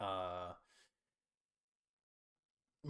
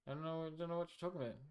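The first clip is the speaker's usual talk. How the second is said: fast denial; 7 semitones higher, much faster than usual. Pretend. Playful denial.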